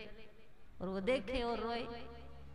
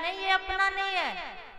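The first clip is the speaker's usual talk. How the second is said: eager son's high voice; 9 semitones higher, 4 dB louder than usual. Acted. Son voiced reassuring father.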